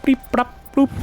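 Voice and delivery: high-pitched mouth-made beeps and bloops; short electronic blips made by voice; imitated beeping. Player voicing a game gadget, playful imitated beeps. Sound effects while playing.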